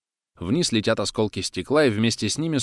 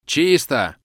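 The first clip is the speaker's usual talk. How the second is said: higher, louder yell of clear; shout; 6 semitones higher, 6 dB louder than usual. Acted. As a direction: bellow it as a team. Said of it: "орут они".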